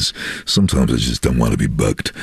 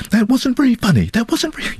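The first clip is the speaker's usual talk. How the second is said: high-pitched lady voice. Retold or imitated. Ladies on the train quoted.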